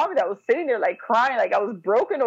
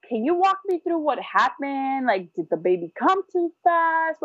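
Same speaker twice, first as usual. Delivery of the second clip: higher-pitched imitation of a doctor's questions; questioning doctor; 6 semitones higher than usual. Doctor asks mother what happened at the birth, questioning in imitation. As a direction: put on a doctor asking, pitched up.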